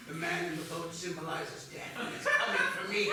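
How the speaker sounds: loud, cracking-up melodrama; hammy dying husband. Acted. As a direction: ham up a deathbed speech. Dramatic dying speech.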